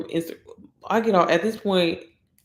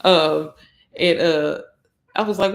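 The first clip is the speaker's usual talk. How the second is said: normal talking voice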